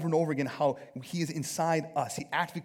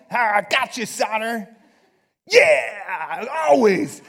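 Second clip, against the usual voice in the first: loud, higher wrestler-style taunt; macho gotcha; 8 semitones higher, 14 dB louder, much slower than usual. Acted. Preacher mocking combative believers, loud macho taunt. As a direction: crow it like a hammed-up macho brawler.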